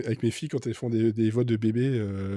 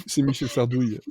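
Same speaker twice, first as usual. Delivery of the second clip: ordinary talk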